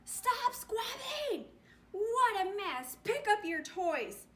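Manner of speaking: high, loud scolding shout; fed-up mother toad shouting at her toadlets; yelling. Acted. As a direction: yell at the squabbling kids. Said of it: "he heard her yelling inside".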